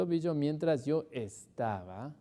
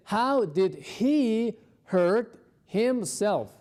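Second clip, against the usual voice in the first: slow model question; 5 semitones higher, 4 dB louder, much slower than usual. Shown as a demonstration. Teacher models question slowly.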